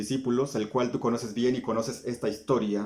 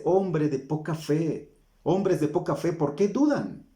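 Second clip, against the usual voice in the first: higher, slow, stern reproach; preaching rebuke; 4 semitones higher than usual. Acted. Preacher voicing Jesus scolding doubters, higher and stern. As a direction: scold the faithful for their doubt.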